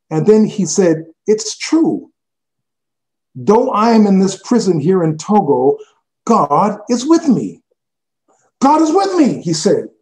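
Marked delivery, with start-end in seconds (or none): 1.3-9.4 high, loud, rising joy; carried away telling God is near; excited. Retold or imitated. Imprisoned pastor to visitor, high excited joy. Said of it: "he was quite excited when he said it".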